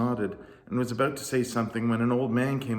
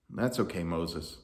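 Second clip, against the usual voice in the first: calm, gentle voice; 4 semitones lower, 5 dB quieter than usual. Acted. God speaking calmly.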